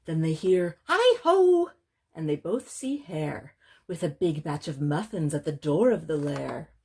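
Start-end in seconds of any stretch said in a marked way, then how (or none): 0.9-1.7 high, loud storybook hail; cheery call. Acted. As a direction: call out a high-pitched greeting from the doorway. Hare calls out from the door.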